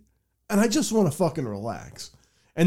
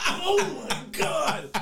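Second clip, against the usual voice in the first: very high, stretched-out laughing exclamation; helpless, softer laugh through the words; laughing disbelief; 12 semitones higher, 4 dB quieter, much slower than usual. Retold or imitated. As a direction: crack up in disbelief, slow and squeaky high. Cracking up at lost key note.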